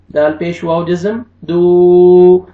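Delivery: ordinary talk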